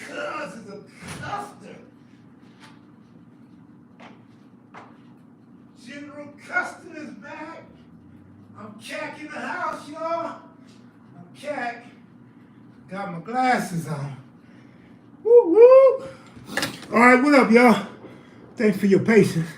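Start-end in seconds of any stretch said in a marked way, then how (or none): 0.1-11.9 high put-on announcer voice, held back in volume; lightly done grand-entrance voice; hype man intro. Hyped self-introduction on returning to stream. 15.2-16.1 high, loud laughing whoop; real excited woo-woo. Real, not acted. Whoops on returning to the stream.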